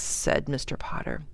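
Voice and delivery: ordinary delivery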